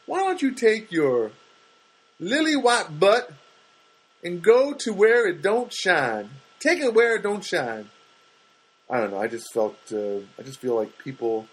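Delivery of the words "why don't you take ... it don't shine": sarcastic retort. Retold or imitated. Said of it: "And it's like".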